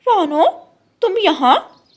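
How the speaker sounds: surprised exclamation. Acted. The crow finds Rano in the fine house, loud surprise. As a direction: cry out in disbelief at the house.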